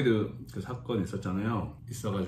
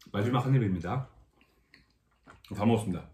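talking while chewing; last bite in mouth; mouth full; faster than usual. Real, not acted. Seen 4: Spoon to mouth at 0.1, cheeks full, chewing through 1.6.

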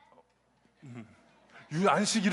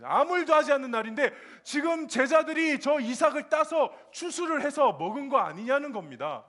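ordinary delivery

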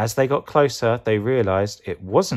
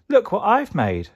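high, excited veggie voice; high-pitched playful boast; proud show-off; 12 semitones higher, 3 dB louder than usual. Acted. Tomato proudly shows off creation.

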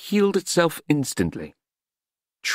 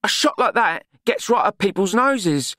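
a higher, brisk no-nonsense woman; telling Nutt off firmly; scolding Glenda; 7 semitones higher than usual. A narrator's reading.

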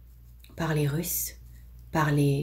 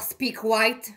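higher, forceful barked order; harsh barking commands; 6 semitones higher than usual. Acted. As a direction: bark it like a foreman. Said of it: "Un peu plus fort alors".

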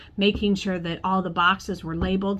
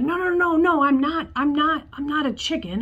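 protesting; 7 semitones higher than usual. Retold or imitated. Student protesting being called chicken.

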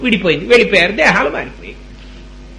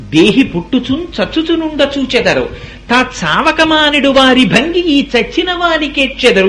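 slow chanted verse, climbing higher; verse recitation; 4 semitones higher than usual. Acted. Preacher quotes a great saying to mother, chanted recitation. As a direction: intone the poem with long-held syllables.

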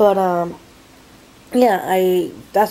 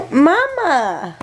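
whiny cry; 6 semitones higher than usual. Real, not acted. Toddler calling.

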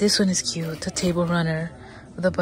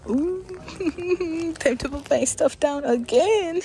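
high, laughing voice; a high, giggly sigh; 8 semitones higher than usual. Real, not acted. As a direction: laugh at yourself. Laughing at her full hands.

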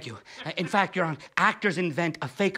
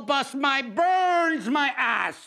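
drawn-out gripe; 5 semitones higher, much slower than usual. Acted. Comic outrage at fourth wall.

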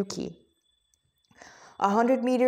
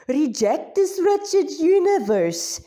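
slow, higher, hammed-up fake Hindi accent; deliberately terrible Hindi accent, slow and raised; bad Hindi accent; 6 semitones higher, 3 dB louder, slower than usual. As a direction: preach it in the worst Hindi accent you can. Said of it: "the worst Hindi accent in existence".

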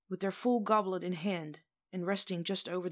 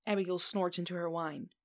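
plain reading voice

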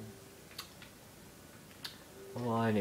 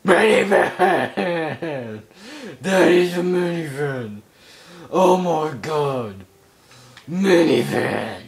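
loud, higher, slow drawn-out outrage; shocked outcry; 6 semitones higher, 8 dB louder, slower than usual. Real, not acted. Gamer aghast at finding a minivan, loud outcry. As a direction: stretch out the shocked cry.

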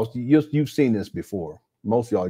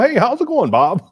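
much higher, bright-pitched polite greeting; friendly everyday voice; 13 semitones higher, 8 dB louder than usual. Shown as a demonstration. Showing viewers how he talks to people off camera, chirpy high greeting. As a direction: switch to a breezy, high, sociable hello. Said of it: "when I talk to people".

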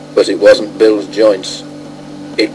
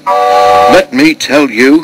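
slow, raised indignant start; huffy, higher and louder, very deliberate; 4 semitones higher, 5 dB louder, much slower than usual. A narrator's reading. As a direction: draw it out in indignation. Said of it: "let off steam indignantly".

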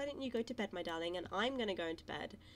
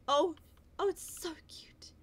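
higher unvoiced whisper of adoration; whispered gush; 8 semitones higher, 5 dB louder than usual. Real, not acted. Gamer whispering over a cute foal. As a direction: breathe it out in awe.

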